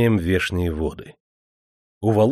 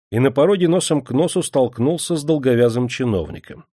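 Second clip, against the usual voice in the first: ordinary delivery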